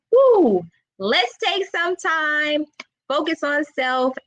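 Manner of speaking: rally yourself into a fresh start; mock cheer. After the breakup, cheering herself on with a high woo. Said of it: "I was like".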